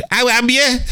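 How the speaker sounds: high mock [name] "How am you?"; mock [name] line. Teller mimicking [name]'s studio slip, high voice. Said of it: "originally he said".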